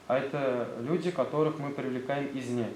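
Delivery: ordinary talk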